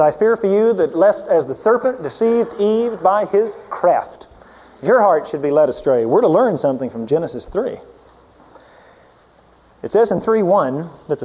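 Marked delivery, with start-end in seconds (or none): 0.0-6.0 louder and higher, quoting Paul; raised quoting voice. Acted. Preacher reading Paul aloud to the congregation, louder and higher. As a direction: lift the voice to read the apostle.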